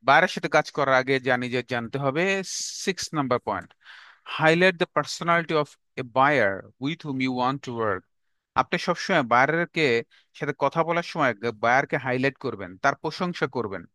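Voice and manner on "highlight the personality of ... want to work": slow dictation. Shown as a demonstration. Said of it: "dictating the point slowly".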